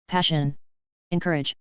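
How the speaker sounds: ordinary delivery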